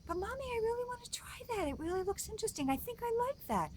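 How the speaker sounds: put-on very high, quick little-kid voice; pleading child.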